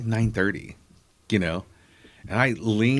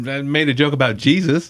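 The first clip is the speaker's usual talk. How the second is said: normal talking voice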